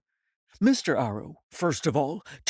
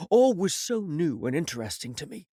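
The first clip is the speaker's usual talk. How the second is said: plain reading voice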